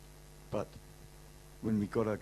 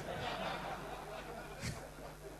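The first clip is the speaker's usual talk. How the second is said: breathy laugh; 11 semitones higher, 10 dB quieter than usual. Real, not acted. Laughing at greeter's reaction.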